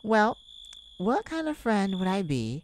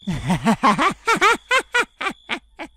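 loud bursting cackle; 4 semitones higher, 10 dB louder than usual. Acted. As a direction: cackle at your own joke. Big laugh at own joke.

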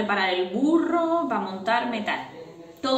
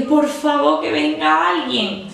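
raised, slow plea for help; louder, higher begging call; pleading call; 6 semitones higher, 4 dB louder, slower than usual. Retold or imitated. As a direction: call out desperately for someone to come. Calling out to a cleaner for help.

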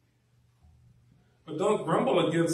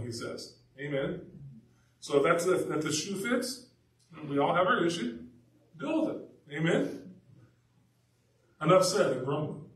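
ordinary delivery

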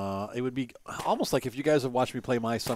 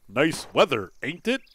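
mock stilted reading without a country accent, tag lifted; over-enunciate each word, no Southern accent at all; 4 semitones higher, 5 dB louder than usual. Player mocking the game's reading, stilted, no country accent. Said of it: "do the country accent instead of, like".

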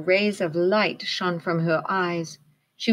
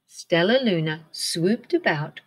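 ordinary delivery

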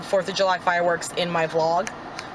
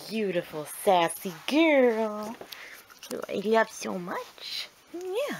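soft, slow pet talk; 4 dB quieter, slower than usual. Real, not acted. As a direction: coo at your dog. Talking sweetly to her dog.

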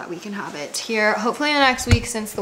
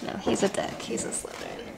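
whispered aside; 7 dB quieter than usual. Real, not acted. Whispered aside after cheering.

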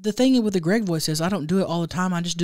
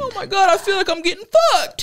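high, loud, alarmed falsetto; comic squeal of realisation; Greg; 6 dB louder than usual. Acted. Startled character in a sketch, high loud squeal. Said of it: "in my Greg voice".

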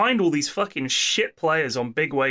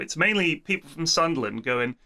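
normal talking voice

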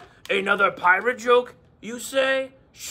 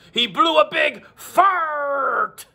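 slow pirate punchline; 7 semitones higher, much slower than usual. Acted. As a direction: drag it out like a pirate. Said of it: "my pirate voice".

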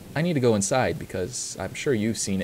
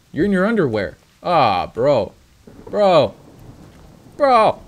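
surprised; 4 semitones higher, 6 dB louder than usual. Real, not acted. Shocked at character's appearance.

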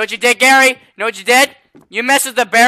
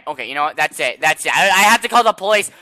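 ordinary talk, nothing marked

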